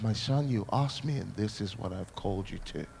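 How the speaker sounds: low, solemn divine voice; voice of God. Acted. Being of light addresses the boy, low and solemn.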